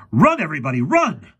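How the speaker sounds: high, loud cry of alarm; panicky yell. A narrator's reading. Professor warns everyone of the robot, panicky. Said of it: "in a panicky voice".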